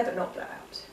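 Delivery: soft unvoiced breath; whispered. Shown as a demonstration. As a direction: whisper it gently. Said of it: "make the flame flicker".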